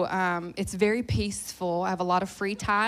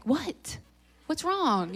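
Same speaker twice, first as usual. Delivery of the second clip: higher-pitched mock bewilderment; retold puzzled reaction; 7 semitones higher, much slower than usual. Preacher answering her husband from the mic, puzzled and higher-pitched. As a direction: call back puzzled, pitch lifted. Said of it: "And I'm like, on the mic".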